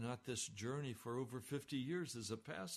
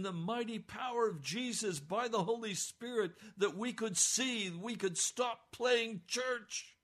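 higher, louder fiery sermon; preaching at full force; 9 semitones higher, 7 dB louder than usual. Real, not acted. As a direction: thunder it from the pulpit.

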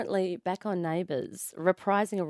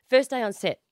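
ordinary talk, nothing marked

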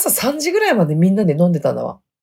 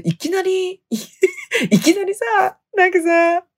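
laughing; 13 semitones higher, much slower than usual. Real, not acted. Laughing at her own tears.

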